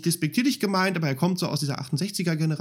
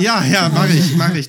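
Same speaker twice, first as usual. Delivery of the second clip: loud, laughing agreement; loud playful; 10 dB louder than usual. Real, not acted. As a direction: call it out jokingly. Joking reply, loud laughing tone.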